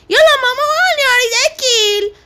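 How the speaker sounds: put-on a kid pestering mum for food; whiny little son. Son Hamoushi demands food.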